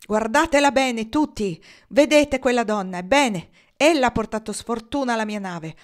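loud, high, booming denunciation; a furious captain pointing out the woman to everyone; thundering. A narrator's reading. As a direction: thunder it at the crowd like a curse. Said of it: "tuonò".

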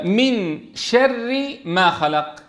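very slow, measured recitation; slow recitation example. Shown as a demonstration. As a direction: recite the example slowly, stretching the sounds. Teacher recites an example slowly.

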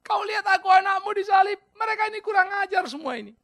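high, loud shouted demand for slaughter; hammed-up vengeful cry; mock vengeful plea. Pretend Jesus begging the Father to slaughter them, shouting. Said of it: "Dia nggak bilang".

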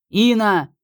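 calling out high and loud into a storm; shouting over wind. A narrator's reading. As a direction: yell her name over the howling wind. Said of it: "позвал он, стараясь перекричать ветер".

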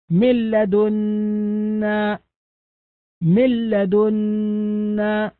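من لدنا said very slowly, the nun fully merged into a doubled lam, "millada"; slow tajweed demo of من لدنا with no ghunna, the lam doubled; full assimilation demo. Shown as a demonstration. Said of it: "فنقول". (نطق من لدنا ببطء بلام مشددة دون غنة)

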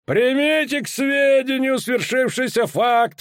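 high, slow official proclamation; stern commander. A narrator's reading. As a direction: announce the sentence coldly from above. Danza proclaiming from gallery. (суровый командир)

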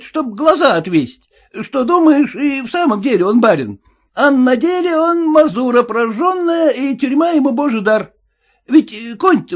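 high suspicious cabbie; cab driver voice. Acted. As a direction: play the sly cabbie, pitched well up.

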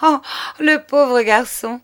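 bursting out laughing. A narrator's reading. Juliette laughs off Noël's marriage, mocking. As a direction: laugh it out at the poor boy. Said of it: "en éclatant de rire".

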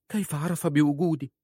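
high-pitched, alarmed wife's question; acted panic in a woman's voice. A narrator's reading. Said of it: "وفتحت فمها في فزع".

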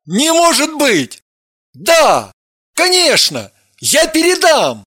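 high-pitched, excited cries; agitated shouting. A narrator's reading. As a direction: exclaim in shock into the phone. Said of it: "утратил невозмутимость и закричал".

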